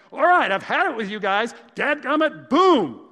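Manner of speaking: loud, high, exasperated outburst at the class; fed-up teacher yell. Retold or imitated. Teacher finally losing it with the class, loud angry yell. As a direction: blow up at the kids at last. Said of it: "'Cause he finally got angry".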